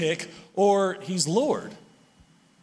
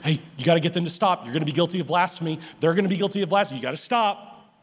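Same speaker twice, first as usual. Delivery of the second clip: hurried Pharisee warning; 3 semitones lower, much faster than usual. Acted. Preacher voicing Pharisees pressing Jesus, fast and urgent.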